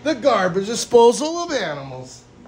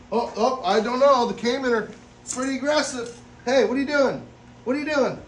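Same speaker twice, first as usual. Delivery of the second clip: ordinary talk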